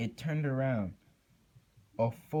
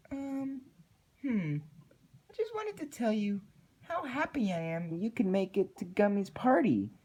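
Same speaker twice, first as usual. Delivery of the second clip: very high, quick chirpy Pinkie voice; exclaimed; 11 semitones higher than usual. A narrator's reading. Creepy Pinkie suddenly inviting Twilight to a party, high and chirpy. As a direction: gush it out of nowhere. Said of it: "exclaimed Pinkie out of nowhere".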